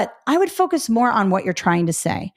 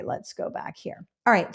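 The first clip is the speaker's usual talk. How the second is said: ordinary delivery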